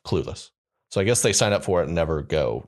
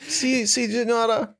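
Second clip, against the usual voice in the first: mock accent; slower than usual. Mocking foreign phrase.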